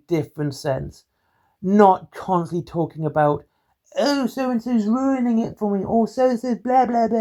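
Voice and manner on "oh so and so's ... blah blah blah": high whiny mock complaint; whiny complainer. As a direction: mimic a whiny complainer, pitched up. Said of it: "mocking complainers' quotes".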